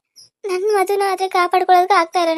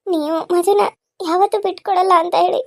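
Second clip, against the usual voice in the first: usual speaking voice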